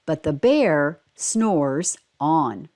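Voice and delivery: slow, low refrain; reading the repeated line of a picture book slowly. A narrator's reading. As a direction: let the refrain drag out sleepily. Story refrain.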